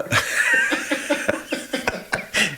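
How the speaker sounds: breathy high laughter; real laughter. Real, not acted. Recalling Joe's pick secret, breaking into laughter.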